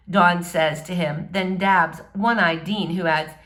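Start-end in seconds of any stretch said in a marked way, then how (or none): none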